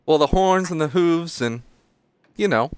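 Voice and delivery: put-on high, quick little-kid voice; small child. Halfling child questions Finny, in a high child voice. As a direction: pipe up like a curious kid.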